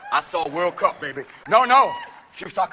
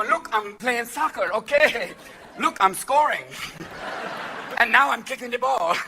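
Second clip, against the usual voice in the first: put-on Brazilian accent; 6 semitones higher, 4 dB louder than usual. Mock Brazilian player.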